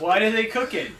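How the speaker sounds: loud, high-pitched baffled outburst; exasperated shout. Real, not acted. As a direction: shout the question in comic disbelief. Repeats question, louder each time.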